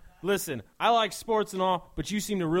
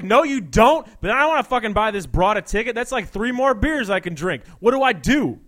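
loud, higher bro voice; 5 semitones higher, 10 dB louder than usual. Acted. Bro asking his buddy for advice, loud. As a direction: bark it like a meathead.

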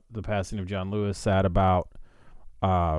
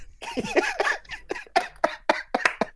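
long high-pitched laugh; 4 dB quieter than usual. Real, not acted. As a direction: burst into a high squealing laugh. Reacts to pee joke.

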